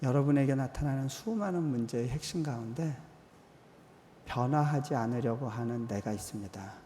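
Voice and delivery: usual speaking voice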